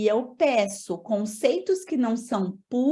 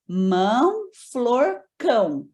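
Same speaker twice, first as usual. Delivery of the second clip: Mão, flor, cão each said apart, a touch louder; words set apart; 3 dB louder, much slower than usual. Shown as a demonstration. Teacher reading test words to child, each set apart. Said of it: "Aí você faz assim".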